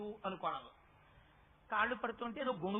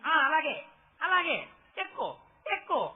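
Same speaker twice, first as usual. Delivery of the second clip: loud encouraging call; 4 semitones higher, 8 dB louder, slower than usual. Acted. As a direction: call down loudly, urging him to climb. Guru encouraging from well edge. (బావిలోని వాడిని ఎక్కమని గట్టిగా ప్రోత్సహిస్తూ)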